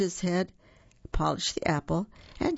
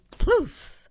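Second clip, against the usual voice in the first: sound effect; 6 dB louder than usual. A narrator's reading. Leaves burst as Duck lands.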